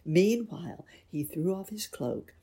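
ordinary delivery

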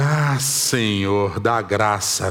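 low, long-drawn sigh of a plea for patience; exasperated prayer. Retold or imitated. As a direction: draw it out slowly, praying for patience. Muttered prayer for patience in retelling. (súplica arrastada, pedindo paciência)

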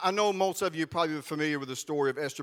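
ordinary talk, nothing marked

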